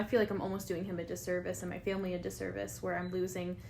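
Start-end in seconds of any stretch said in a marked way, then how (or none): none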